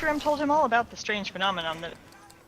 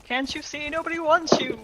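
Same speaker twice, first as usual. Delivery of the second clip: raised, higher-pitched dismissal; acted rejection; 6 semitones higher, 6 dB louder than usual. Cowboy tells the dragon to leave, acted insistent rejection. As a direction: drive them off, building to a louder finish.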